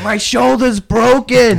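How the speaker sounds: high, slow drawn-out yell of pain; mock pained yell. Drunk friend yelling while being carried. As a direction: wail that the shoulder is broken. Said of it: "yelling this dude".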